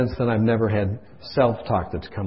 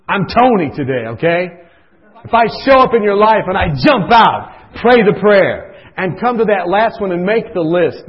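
much higher and louder, preaching at full force; fervent pleading; 9 semitones higher, 8 dB louder than usual. Real, not acted. Preacher urging the congregation to act, loud and fervent.

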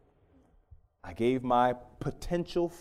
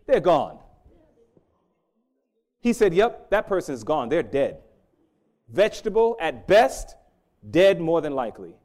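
preaching; 2 semitones higher, 7 dB louder than usual. Acted. Preacher quoting the neurologist loudly.

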